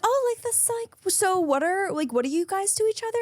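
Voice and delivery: high, syrupy fake-nice; bright passive-aggressive questioning; mock sweet voice. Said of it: "So I'm like".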